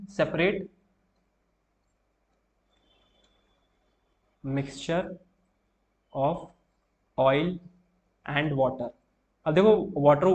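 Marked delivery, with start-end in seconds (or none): 0.1-8.9 word by word with long pauses, for notes; slow, spaced dictation for students taking notes. Shown as a demonstration. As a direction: spell it out slowly so they can write it down. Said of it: "धीमे ही बोलूँ".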